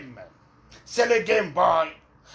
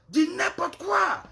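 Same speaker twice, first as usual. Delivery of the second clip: much higher and slow, outraged; the same words again, pitched way up in disbelief; shrill exasperation; 13 semitones higher, slower than usual. Real, not acted. Repeating herself to the women on her live, much higher.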